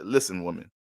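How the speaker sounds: low, cold and detached; stern father. Retold or imitated. Father shutting down his wife, low and cold. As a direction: shut her down, serious and low.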